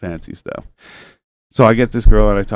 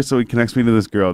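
usual speaking voice

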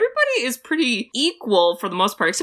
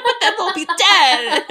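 laughing; 11 semitones higher, 4 dB louder than usual. Retold or imitated. Quoting a character, laughing.

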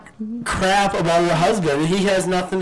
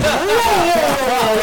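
high surprised whoa; mock shocked whoa; 12 semitones higher, 5 dB louder, slower than usual. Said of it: "I'm like".

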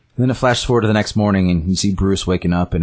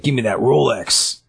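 slow, higher-pitched mock goon; robber's demand; 5 semitones higher than usual. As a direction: imitate a mugger, drawing out the demand. Retold goon robbing Harvey.

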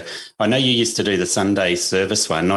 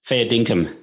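Australian slang; 3 semitones higher, slower than usual. Real, not acted. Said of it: "What's that one?".

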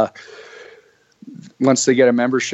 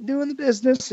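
much higher mock Arn voice; Arn impression. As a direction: rant like the old-timer. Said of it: "everybody has their own Arn impersonation".

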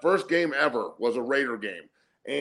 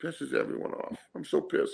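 whispered mockery of the sulking quarterback; 2 semitones higher, 8 dB quieter than usual. Host mocks the quarterback's sad interview, in a whisper. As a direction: breathe it out like a pouting whine.

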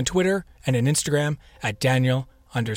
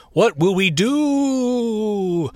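drawn-out, much higher, hammed-up lament; mock dramatic despair; 8 semitones higher, 4 dB louder, much slower than usual. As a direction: wail it slowly like a melodrama. Mock despair about missing trick-or-treating.

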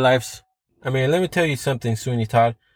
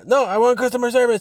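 higher, insistent; frustrated demand; 8 semitones higher, faster than usual. Retold or imitated. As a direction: caller at the end of his tether. Arguing with phone robot.

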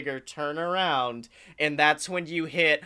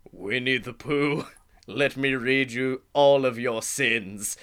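Satan voice; 7 semitones lower than usual. Retold or imitated. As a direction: play Satan reading out the sins, deep and dark. Satan voiced in retold ride.

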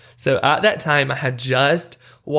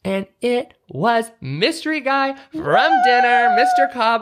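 excited reveal; 10 semitones higher than usual. Real, not acted. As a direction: announce him like big news. Dramatic reveal of mystery guy.

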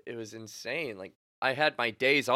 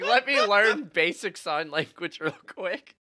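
strained, raised voice, a bit louder; imitating lost voice; 7 semitones higher, 6 dB louder than usual. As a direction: force words out as if voiceless. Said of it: "trying my best to be somewhat vocal".

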